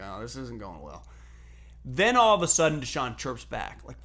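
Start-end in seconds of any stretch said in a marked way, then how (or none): 0.0-1.1 much softer, worried aside; uneasy under breath. Retold or imitated. Teammate quietly worried as the coach and player clash, hushed. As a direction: mutter it like a teammate watching trouble. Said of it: "you can tell Macklin".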